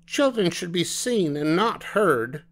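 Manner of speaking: high-pitched huffy reprimand; indignant scolding. A narrator's reading. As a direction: scold noisy children in a high voice. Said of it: "face got very red".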